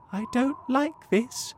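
high, worried little voice; uneasy ladybird. A narrator's reading. Ladybird dislikes the strange cloud creatures, uneasy. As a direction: say it as an uneasy ladybird, pitched well up.

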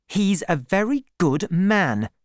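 high-pitched, mildly angry retort. A narrator's reading. Woman defending Troy to Gabriel, mildly angry. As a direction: defend him with an edge of anger. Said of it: "said Bathsheba angrily".

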